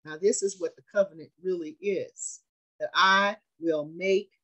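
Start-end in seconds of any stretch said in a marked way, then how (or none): 2.8-4.3 slow reading. Acted. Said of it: "slow scripture reading".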